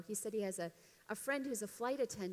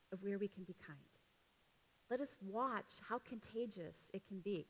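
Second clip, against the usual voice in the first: ordinary talk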